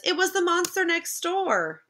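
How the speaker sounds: high little-brother voice; kid insisting. Acted. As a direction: insist like a small child. Child insists.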